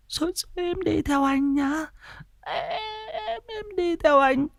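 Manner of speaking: very high, soft, ghostly coo; eerie possessed voice. Acted. Possessed Hà cooing to an unseen lover, high and ghostly. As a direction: lure someone away in a haunted sing-song.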